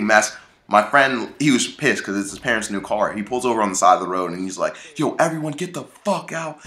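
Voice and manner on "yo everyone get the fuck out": angry order. Retold or imitated. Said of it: "he was just pissed".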